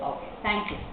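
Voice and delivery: usual speaking voice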